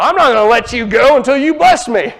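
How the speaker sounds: hammed-up insistence, not letting go; half-mocking a stubborn, defiant Jacob; stubborn demand. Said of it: "it might sound like, "Well, Jacob, he's just being his old stubborn self".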